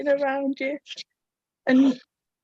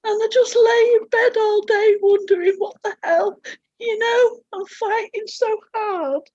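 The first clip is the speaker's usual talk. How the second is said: high, tear-choked; crying; 6 semitones higher, 4 dB louder than usual. Real, not acted. Describing a day in bed, crying higher. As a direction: wail through tears. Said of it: "I'm sat here in bits".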